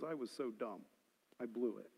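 quiet regret. Retold or imitated. Retells the grown man's regret.

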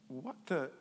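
low, soft, half-asleep mumble; groggy waking mumble. Retold or imitated. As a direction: mumble it just woken up. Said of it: "rubbing the sleep out of his eyes".

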